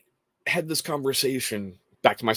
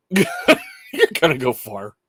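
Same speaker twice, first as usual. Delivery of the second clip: slow, louder, high-pitched through laughter; laughing; 4 semitones higher, 7 dB louder, much slower than usual. Real, not acted. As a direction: crack up as you say it. Cracks up repeating his own retort.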